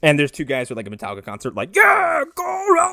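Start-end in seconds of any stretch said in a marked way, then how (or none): 1.7-2.9 high, loud drawn-out cheer; fan cheering. Retold or imitated. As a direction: whoop like a metalhead fan. Imitating cheering metal fans.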